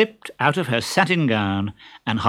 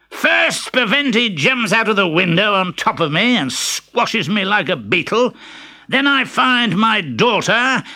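Pantalone; 9 semitones higher, 3 dB louder than usual. Acted. The father bursting in on the lovers, indignant. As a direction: cut in with outrage.

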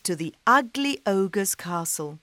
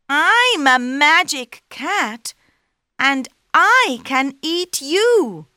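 cat voice; 8 semitones higher, 8 dB louder than usual. Acted. Cat boasts back.

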